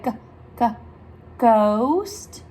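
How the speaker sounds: GHOST led in by two separate hard G sounds; wide mouth, lone G twice, then "ghost"; G sound demo. Shown as a demonstration. Teacher has children open wide, G isolated before a drawn-out GHOST. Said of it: "Open your mouth real wide and say".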